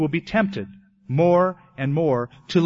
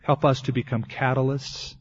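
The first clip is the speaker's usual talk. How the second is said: prayer. Acted. Closing prayer.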